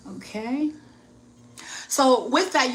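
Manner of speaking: usual speaking voice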